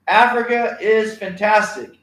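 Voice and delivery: loud, slow rally chant, voice up high; rally call. Shown as a demonstration. Teacher leading the class in a rally call, loud and slow. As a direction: lead the class in a big chant. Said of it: "I want you to say".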